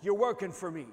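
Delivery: firm, loud reply, easing on the repeat; lightly voiced divine correction; Holy Ghost speaking. Holy Ghost answers the screaming worker, loud firm correction. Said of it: "I heard the Holy Ghost say".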